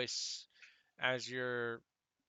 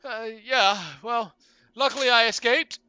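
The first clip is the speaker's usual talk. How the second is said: higher and louder, a breezy hero in a pickle; player voicing Felix; 11 semitones higher, 13 dB louder than usual. Felix asking the captain for help, breezy and bright. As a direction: play it cool while asking for help.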